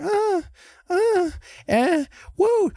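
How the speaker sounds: high, far-off spooky cries; startled ghostly wails. Retold or imitated. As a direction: wail it out high and eerie. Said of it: "then all of a sudden we hear".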